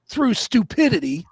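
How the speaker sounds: ordinary delivery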